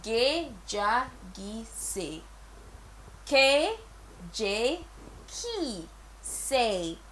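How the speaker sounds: "Ge, ja, gi, se" split into separate syllables with pauses. Shown as a demonstration. Teacher breaking the greeting into syllables for learners. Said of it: "Say it as it sounds".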